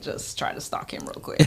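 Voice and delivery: whispered under her breath; conspiratorial whisper. Retold or imitated. A woman whispers her plan to stalk a star, hushed. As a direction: hush it like a secret plan. Plotting to stay and stalk Jordan.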